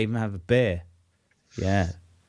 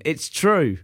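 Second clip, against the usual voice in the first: ordinary talk, nothing marked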